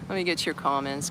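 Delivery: ordinary delivery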